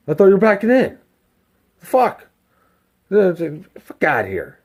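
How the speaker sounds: loud, high-pitched irritated snap; retold angry outburst. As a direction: act out a guy griping at a driver. Retells the annoyed guy's outburst.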